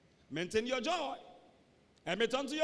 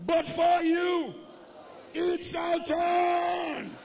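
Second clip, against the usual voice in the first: high, loud drawn-out declaration; shouted church proclamation, slow on the big phrases; full-force preaching; 6 semitones higher, 7 dB louder, slower than usual. Real, not acted. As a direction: preach it out loud for the congregation to repeat. Leads congregation in declaration.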